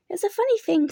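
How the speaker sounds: a touch of a fussy rabbit. A narrator's reading. Rabbit admits he forgot, lightly voiced.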